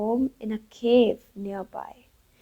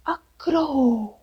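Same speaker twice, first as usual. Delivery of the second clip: high, drawn-out startled exclamation; gasp, then stretch it out in amazement; theatrical shocked discovery; gasped astonishment; 4 semitones higher than usual. Acted. Owls gasp at seeing crow.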